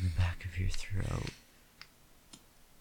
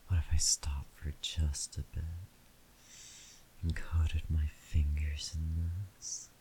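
whispering. Acted. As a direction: whisper close to the mic. Intimate whispered roleplay.

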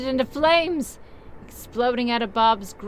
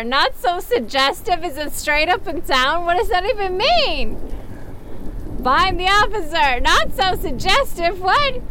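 incredulous; 7 semitones higher than usual. Real, not acted. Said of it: "mocking reading, rising".

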